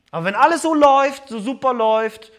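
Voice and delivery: high, loud and fast, bubbling over; a giddy rush of good-times excitement; excited. Acted. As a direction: gush about how great it all is. Pastor acts carefree high life.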